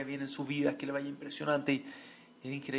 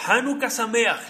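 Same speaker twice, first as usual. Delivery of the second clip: slow, raised, louder greeting; greeting in an accent; 8 semitones higher, 9 dB louder, slower than usual. Retold or imitated. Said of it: "pero con un acento". (saludo con acento, más agudo y lento)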